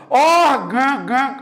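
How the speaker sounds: "Órgão" slowly, the last syllable held closed in the nose; som nasalizado. Shown as a demonstration. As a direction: stretch and hum the "gão" of "Órgão" through the nose. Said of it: "vai sentir o seu nariz". ("Órgão" lento, com o "gão" fechado e bem nasal)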